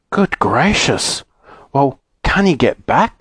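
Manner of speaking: slow, alarmed Fanny. A narrator's reading. Fanny hears Moonface is stuck, alarmed. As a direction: draw out the shock, worried. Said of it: "said Fanny in alarm".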